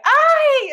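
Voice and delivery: sharp high yelp of fright; a shrieked "Ay!" like someone jumping out of their skin; imitating a woman's scared shriek; startled scream. Stranger startled by hiding teen.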